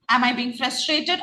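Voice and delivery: ordinary talk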